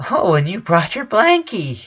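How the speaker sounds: high cooing voice; baby talk. A narrator's reading. Brother talking to baby sister, high cooing. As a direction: coo about the blanket.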